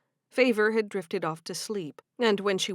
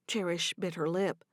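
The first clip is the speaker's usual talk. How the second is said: ordinary delivery, nothing marked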